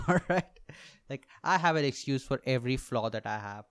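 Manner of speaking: normal talking voice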